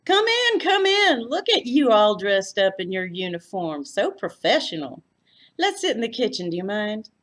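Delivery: loud, high, gushing South Texas welcome; Texas hostess. A narrator's reading. Floy greeting her uniformed guest, gushing Texas hostess. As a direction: fuss over the guest like a Texas aunt.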